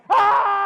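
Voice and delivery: high shouted mock scream; a chicken at a haunted house, eyes shut; imitating a scream. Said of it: "my eyes closed and going".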